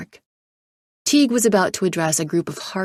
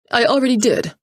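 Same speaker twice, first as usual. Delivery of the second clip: with a sigh; 6 semitones higher, 6 dB louder than usual. A narrator's reading. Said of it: "said Patel with a sigh".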